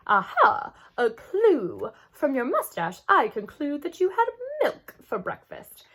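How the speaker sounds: hammed-up detective, high and loud; big sister teasing as a pretend detective; playful detective. A narrator's reading. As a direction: play the great detective. Playing detective.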